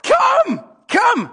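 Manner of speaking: ringing high-pitched command; Jesus calling. Acted. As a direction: call it out over the water. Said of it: "Jesus said what?".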